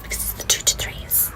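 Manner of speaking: hushed whisper so they can't hear. Acted. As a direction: whisper it, scared of the kids. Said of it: "Why are you whispering?".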